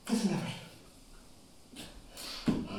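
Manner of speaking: dying father's voice fading out; fading breath. Acted. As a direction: let the voice give out.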